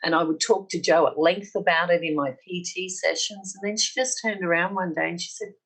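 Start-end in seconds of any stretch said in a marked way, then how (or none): none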